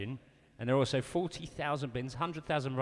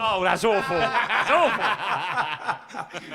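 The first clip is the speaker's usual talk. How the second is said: loud, high, laughing outburst; 5 semitones higher, 6 dB louder, much slower than usual. Real, not acted. Bandmate heckling a poor Australian accent attempt, laughing loudly. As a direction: break up laughing as you jeer.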